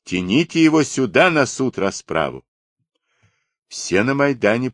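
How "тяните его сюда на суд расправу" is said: higher, moderately raised order; shouting. A narrator's reading. Ataman ordering the man brought to judgment, shouting. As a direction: call out a command. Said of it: "закричал Радилов".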